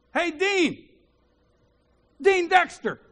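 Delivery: put-on high, loud hail to an old acquaintance; calling out. Man in a crowded foyer hails Dean, calling out.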